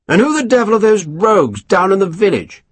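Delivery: lightly voiced self-satisfied officer; a hint of a smug English colonel; pompous colonel. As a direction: savour each word, pleased with yourself. Colonel indignant.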